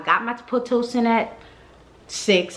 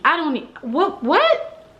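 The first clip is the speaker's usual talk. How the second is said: baffled what; 8 semitones higher, slower than usual. Retold or imitated. Baffled by Benadryl offer.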